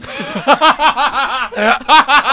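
helpless loud laugh; real uncontrollable laughter; loud burst of laughter. Real, not acted. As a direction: break down laughing on air. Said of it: "I couldn't contain, uh, my laughter".

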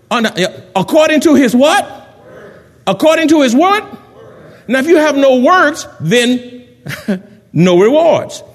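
high, insistent call to the congregation; forceful preaching. Real, not acted. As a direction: press the question on the crowd, ringing out.

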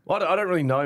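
usual speaking voice

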